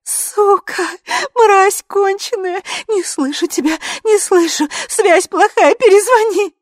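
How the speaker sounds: very high, loud wail; high, keening abuse; howling. A narrator's reading. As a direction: howl to drown someone out. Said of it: "выла Ви".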